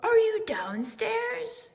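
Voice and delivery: high, loud, slow call; crying out. A narrator's reading. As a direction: shout the question down the stairs. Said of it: "he cried".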